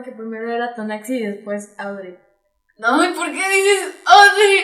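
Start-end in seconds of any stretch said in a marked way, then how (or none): 2.8-4.6 high, loud, drawn-out wail; dismayed outcry. Real, not acted. Sister protesting how her name is said, wailed.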